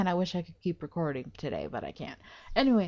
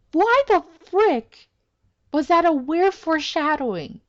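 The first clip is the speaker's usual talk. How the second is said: louder and higher in shocked disbelief; stunned outburst; 7 semitones higher, 4 dB louder than usual. Real, not acted. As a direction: let it burst out in shock. Shocked at the upside-down room.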